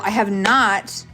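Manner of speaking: normal talking voice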